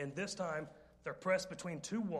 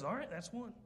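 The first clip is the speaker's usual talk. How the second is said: calm higher flat threat; deadpan warning; 5 semitones higher than usual. Retold or imitated. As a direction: say it coolly, as the punchline. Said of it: "He looks at her and goes".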